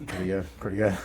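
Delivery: usual speaking voice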